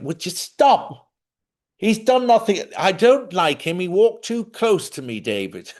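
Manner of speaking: high, loud, put-on posh English scold; hammed-up indignant mother; posh mum scolding. Mother scolding David at the lawyer, in a high loud posh voice.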